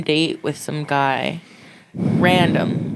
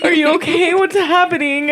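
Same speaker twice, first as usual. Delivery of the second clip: high, frantic and breathless; 12 semitones higher than usual. Retold or imitated. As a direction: beg for an answer, panicking. Retold frantic call.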